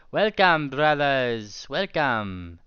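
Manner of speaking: loud, grand greeting; booming welcome. Acted. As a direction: greet them like a proud lord. Griffin greets the party.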